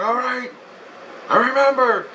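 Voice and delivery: high, drawn-up retold reply; exasperated agreement. Patient answers the woman's repeated orders, exasperated agreement. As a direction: give in with high-pitched mock exasperation.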